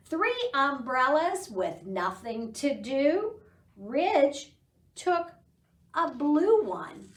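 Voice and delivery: slow, sing-song poem reading; teacher reciting a counting poem to toddlers, slow sing-song; poem recitation. Acted. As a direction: recite the rhyme slowly for children. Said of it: "So the poem goes".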